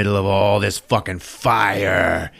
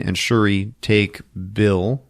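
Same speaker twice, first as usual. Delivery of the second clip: ordinary delivery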